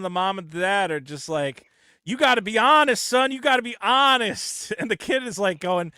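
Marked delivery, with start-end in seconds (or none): 2.1-4.7 mock earnest parent. Parents urging son to be honest.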